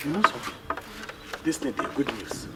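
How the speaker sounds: very low, soft murmur; hushed confiding. Acted. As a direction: share good news in secret. Confiding a secret to his friend.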